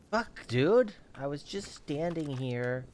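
loud, high, indignant shock; startled outburst. Real, not acted. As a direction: yell at the sudden hit. Attacked while standing still.

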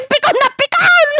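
mocking taunt. Real, not acted. Taunting rival.

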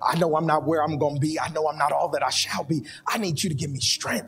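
fervent prayer. Acted. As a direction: cry out to God with growing force. Preacher breaks into fervent prayer.

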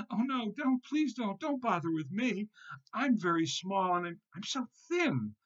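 very high little goat begging; frightened pleading. A narrator's reading. Tiny goat begs the troll not to eat him, frightened pleading. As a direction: plead for your life in a tiny voice. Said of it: "began to shake and tremble".

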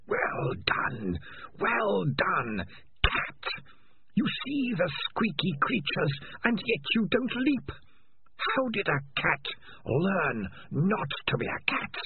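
slow, high and sinister; drawn-out mocking creature voice; eerie alien voice. Alien voice mocks.

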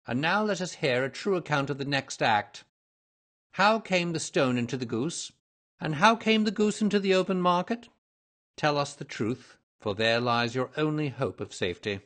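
firm, mildly pressing interrogation; hint of a stern investigator; lightly voiced detective, demanding. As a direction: press for the truth with authority.

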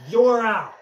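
loud, high, triumphant call; umpire-style call. Pretend. Player calls the batter out, gloating shout. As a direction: holler it like an umpire.

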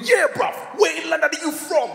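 high, mock-menacing youth chorus; imitated youths challenging a stranger; gang of youths joining in. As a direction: pipe up like one of a gang. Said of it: "they all joined in".

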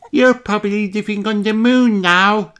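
put-on high, squeaky child pig; a piglet guessing the future with a laugh; squeaky little voice. A narrator's reading. Little Daddy Pig on the tape.

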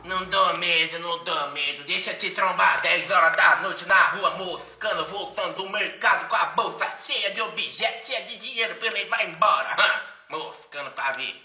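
low, threatening street thug; menacing thug voice. Acted. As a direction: drop low and menace her. Suspect proving he is scary.

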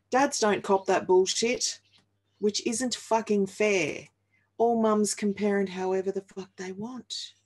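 usual speaking voice

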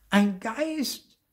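high, loud call of discovery; excited cry. A narrator's reading. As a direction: cry out in amazement. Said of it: "rief Marty".

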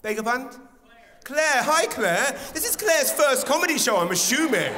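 put-on high, loud gushing over a baby; comic fussing over the baby, high and loud; gushing host. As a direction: coo over the baby to stall a dying gig. Imitating host distracting with baby.

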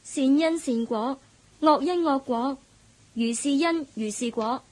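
reciting a Buddhist verse. Acted. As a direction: intone it like scripture. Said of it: "同我講佛偈添啊".

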